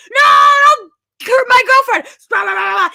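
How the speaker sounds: loud high No, then slow pleading; protective shout. Retold or imitated. Wolfsbane defending her girlfriend, loud protective cry. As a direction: cry out to stop the attack.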